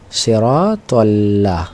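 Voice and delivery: সিরাতাল্লাহ drawn out syllable by syllable; slow recitation. Shown as a demonstration. Teacher points out the lam in a verse, reciting slowly. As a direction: stretch the long vowels of সিরাতাল্লাহ.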